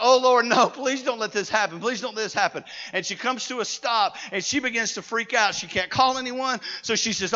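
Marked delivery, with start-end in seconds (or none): 0.0-2.6 loud opening cry, then pleading; retold panicked plea. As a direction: imitate a stranded driver begging God. Stranded woman's panicked plea, retold.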